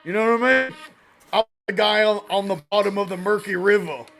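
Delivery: drawled, forceful mock menace; taunting dum-dum persona. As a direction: play the dim river-bottom tough guy. Plays dum-dum taunting version.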